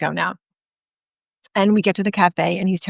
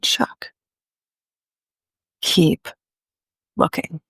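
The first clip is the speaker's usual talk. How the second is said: measured coaxing; 3 semitones lower, slower than usual. Retold or imitated. Retold coaching, urging him to look longer.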